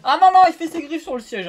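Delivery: scolding the cat. Real, not acted.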